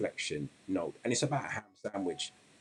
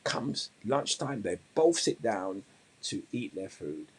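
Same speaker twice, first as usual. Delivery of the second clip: ordinary delivery, nothing marked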